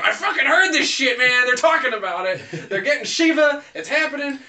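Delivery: loud, high excited mock janitor; janitor gossip. Janitor overhearing Shiva plans, shouting high and loud. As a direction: shout it like a worked-up guy overhearing. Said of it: "a janitor that works over there that was like".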